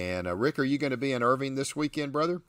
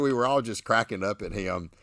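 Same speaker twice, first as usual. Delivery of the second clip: ordinary talk, nothing marked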